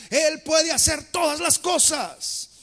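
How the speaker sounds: slow, loud proclamation; preaching shout. Real, not acted. Preacher to congregation, declaring God's power loudly. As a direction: proclaim it with full force.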